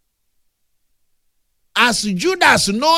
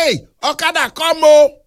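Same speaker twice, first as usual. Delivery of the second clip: loud hail to an okada rider, louder on the end; calling out; 5 semitones higher, 4 dB louder than usual. Retold or imitated. Preacher voicing someone hailing an okada rider, calling out loud. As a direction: shout for the motorbike taxi to come.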